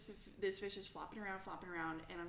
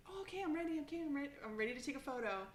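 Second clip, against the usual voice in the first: higher, eager dad voice; 7 semitones higher, faster than usual. Retold or imitated. Dad back with the camera, eager and higher. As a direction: arrive keen with the camera. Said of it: "he's like".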